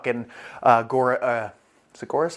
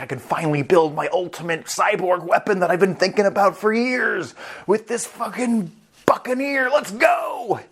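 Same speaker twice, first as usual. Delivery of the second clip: stoked; 5 semitones higher than usual. Retold or imitated. Scientist thrilled to build his cyborg weapon, stoked. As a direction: build to a high-pitched excited yell. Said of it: "he's just stoked".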